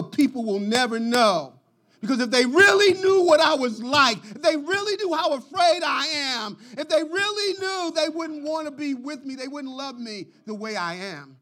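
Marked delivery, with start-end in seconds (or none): 2.0-9.7 pleading. Acted. Confessing fear, voice cracks.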